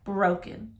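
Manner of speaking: usual speaking voice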